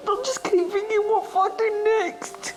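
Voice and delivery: real tears catching the words; crying. Real, not acted. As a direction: voice breaking high into tears. Distraught relative in hospital.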